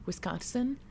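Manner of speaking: "Wisconsin" as wis-CONT-sin; added T. Retold or imitated. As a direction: put a T before the last syllable of "Wisconsin". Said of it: "They add a T".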